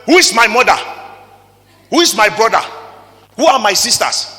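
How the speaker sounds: loud, proclaiming each question to the hall; Jesus taking the mic to challenge the crowd, booming; booming rhetorical questions. Retold or imitated. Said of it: "He took the mic and said".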